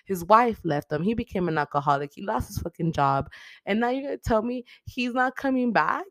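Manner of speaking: usual speaking voice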